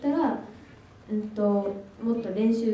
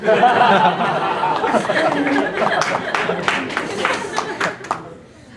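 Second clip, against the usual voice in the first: long loud embarrassed laugh; 2 semitones higher, 9 dB louder than usual. Real, not acted. Student laughing loudly at his own awkward answer. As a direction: burst of sheepish laughter.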